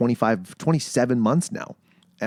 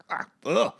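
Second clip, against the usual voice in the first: disgusted; 5 semitones higher, much slower than usual. Retold or imitated. Retold first puff after the question.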